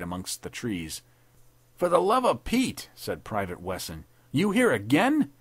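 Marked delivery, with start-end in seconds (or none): 1.8-2.9 high, loud, tough private's voice; exasperated soldier. A narrator's reading. Wesson, to McBride flopping down again, exasperated. 4.3-5.3 high, loud, tough private's voice; exasperated soldier. A narrator's reading. Wesson, to McBride flopping down again, exasperated.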